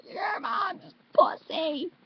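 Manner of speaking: very high, loud, slow scream; screamo scream. Shown as a demonstration. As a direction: stretch every word into a scream. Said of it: "you can put anything in it".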